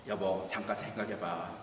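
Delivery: a hint of a coaxing wife, soft and low; wife's coaxing. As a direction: coax the husband quietly. Wife voiced in story.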